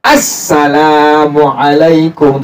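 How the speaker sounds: slow, "Assalamualaikum" with doubled s and ain; teacher modelling the required salam; correct salam. Shown as a demonstration.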